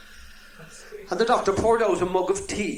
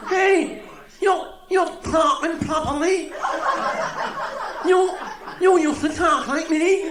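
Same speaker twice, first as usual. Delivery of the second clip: excited shout; 10 semitones higher, slower than usual. Acted. Stammerer now cured, excited shout.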